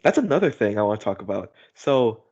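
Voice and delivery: ordinary talk, nothing marked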